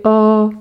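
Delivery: "O" as the open vowel in école. Shown as a demonstration. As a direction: hold "O" with an open, rounded-back sound. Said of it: "c'est un O ouvert".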